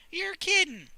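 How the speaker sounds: high, loud disbelief; shocked. Acted. Sally shocked by the sealed well, high and loud. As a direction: gasp it out.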